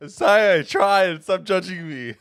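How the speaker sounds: high, loud laughing apology; laughing protest. Real, not acted. Co-caster apologising to chat for the failed accent, laughing loudly.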